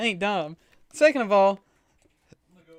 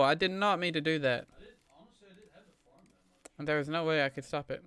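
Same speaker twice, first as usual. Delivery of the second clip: usual speaking voice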